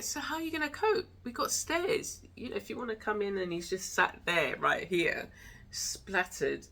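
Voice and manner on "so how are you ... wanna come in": high, fast coaxing talk to the dog. Retold or imitated. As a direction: ask the old dog kindly, quick and high. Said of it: "I'm just like".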